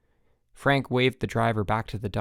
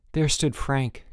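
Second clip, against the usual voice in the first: plain reading voice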